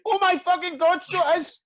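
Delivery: high, loud shriek of disbelief; starstruck shriek. Retold or imitated. Husband retells spotting a star in the hotel corridor, starstruck shriek. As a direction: shriek it out in amazed recognition. Acted shock at seeing [name].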